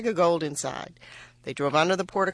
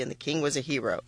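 plain reading voice

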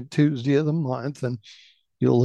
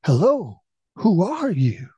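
raised, higher calling voice; loud exclamation; 6 semitones higher than usual. A narrator's reading. Startled son calls out to an unknown voice, exclaiming loudly. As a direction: call it out into the valley. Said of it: "stood up and exclaimed loudly".

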